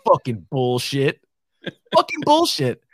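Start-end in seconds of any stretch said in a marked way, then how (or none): 1.9-2.8 much higher mock grumble; high mock line. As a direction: squeak the curse in jest. Repeated line, pitched up.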